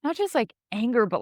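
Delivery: ordinary talk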